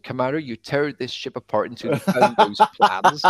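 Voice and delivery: Somerset-accented villain giving orders, played for laughs; Somerset Darth Vader. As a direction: Darth Vader gone West Country Somerset, hammed-up. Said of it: "Dave Prowse was from Somerset".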